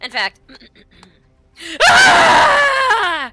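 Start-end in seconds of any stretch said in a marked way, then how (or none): 1.9-3.3 sudden high shout of shock; shocked cry. Acted. Old wizard sees his youth, shocked high cry. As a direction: cry out at the sight of yourself. Old NPC discovers he is young.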